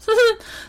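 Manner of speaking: high-pitched hyena chuckle; hyena laughter. Acted. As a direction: giggle like a mocking hyena. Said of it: "between laughs he said".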